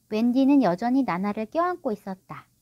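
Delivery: ordinary delivery, nothing marked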